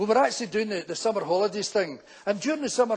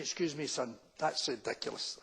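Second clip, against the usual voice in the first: put-on low, soft old woman's voice; quietly scandalised old dear; 6 semitones lower, 11 dB quieter than usual. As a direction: imitate an elderly woman complaining. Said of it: "this old woman comes out to me".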